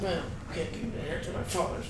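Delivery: very soft, quick sulky grumble; sulking under his breath, hurried and soft; muttered under his breath. Acted. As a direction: grumble it barely audibly to yourself. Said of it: "saying it under his breath".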